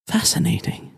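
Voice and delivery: hushed, intrigued whisper; breathy fascinated whisper. Acted. Headmistress studying the creature, whispering in fascination. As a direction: breathe the word out in wonder. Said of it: "looks at Pierup and says".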